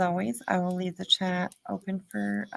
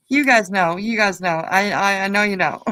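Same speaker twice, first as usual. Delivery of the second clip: much louder, emphatic and teasing; playful insistence; 11 dB louder than usual. Real, not acted. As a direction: belt it out playfully, laughing right after. Teasing the viewers, laughing after.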